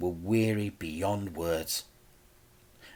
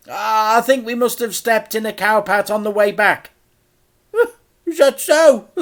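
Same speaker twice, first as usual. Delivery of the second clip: put-on much higher, louder old-lady voice; a fussy auntie greeting; Auntie Flip, cheery; 12 semitones higher, 10 dB louder than usual. A narrator's reading. Flip's voice.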